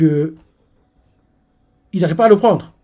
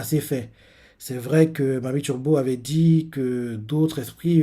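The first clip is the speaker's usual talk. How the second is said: normal talking voice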